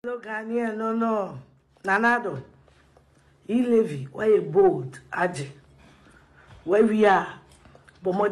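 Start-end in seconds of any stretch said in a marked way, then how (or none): none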